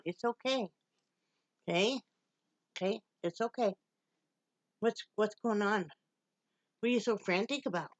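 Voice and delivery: soft, gentle calming of a pet; soothing the dog. Real, not acted. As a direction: settle her down softly.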